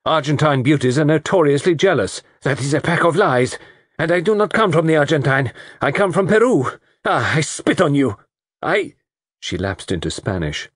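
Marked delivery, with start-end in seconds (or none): 0.0-8.9 high, indignant tirade; heated quarrel. Acted. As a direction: fling it back angrily, pitched high. Heated exchange, Lola spits insults.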